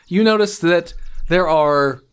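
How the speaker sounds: ordinary talk, nothing marked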